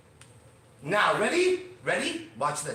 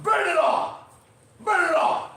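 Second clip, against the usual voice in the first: loud, much higher cry to burn it all; mock outburst; 11 semitones higher, 6 dB louder than usual. Host mock-raging at bills arriving in the mail, loud and high. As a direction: fling it away like a tantrum, loud. Dreading letters full of numbers.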